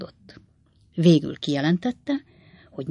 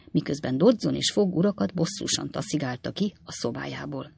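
ordinary delivery

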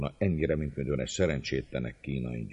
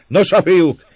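high, loud rallying call; shout to the crowd; 15 dB louder than usual. A narrator's reading. Márton turning to the crowd, loud high shout. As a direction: holler to rouse the lads. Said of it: "Kiáltta a tömeghez fordulva".

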